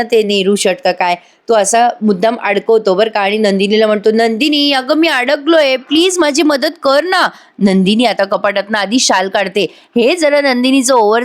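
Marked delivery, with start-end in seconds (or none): 4.1-7.3 high, drawn-out mock plea for help; put-on helpless whine to a wife; mischievous pleading. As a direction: play stuck and beg slowly, pitched up. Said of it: "खोड्या करायचा विचार करतो".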